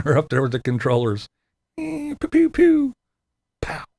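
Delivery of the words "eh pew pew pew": high mock laser zaps; pew pew gun sounds. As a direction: pew pew like a toy blaster. Imitating gunfire in video game.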